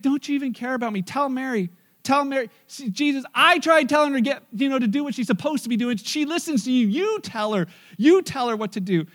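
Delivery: high-pitched, insistent tattling; Martha's indignant complaint. Acted. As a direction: plead your case in a rising fuss. Acts out Martha complaining to Jesus.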